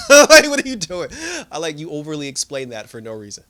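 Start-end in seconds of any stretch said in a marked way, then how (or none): 0.0-1.1 high, loud question breaking into laughter; laughing disbelief. Real, not acted. Viewer laughing at Lynn's needless explaining, amused disbelief. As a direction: laugh through a baffled question.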